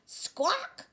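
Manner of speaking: high startled hen squawk. Acted. Startled hen laying an egg, high squawk. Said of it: "cried the startled hen".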